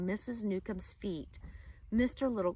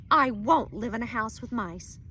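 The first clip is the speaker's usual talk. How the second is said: loud insistence; 8 dB louder than usual. Acted. Upset wife insists.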